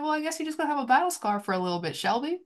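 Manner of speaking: lightly voiced mock scolding of a pet; a hint of cooing at a dented car; playful talk to the car. Talking fondly to her car.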